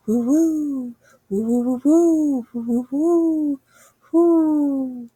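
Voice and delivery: high, drawn-out ghostly woo; spooky wind howl. A narrator's reading. Narrator imitating the forest wind, high spooky howl. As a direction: moan like the wind. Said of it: "That was a scary sound".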